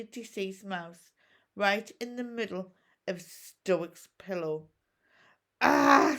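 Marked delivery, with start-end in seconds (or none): 5.6-6.2 loud sharp exclamation; startled cry. A narrator's reading. Hiccup caught out by the dead mouse, startled. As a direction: gasp it out in dismay.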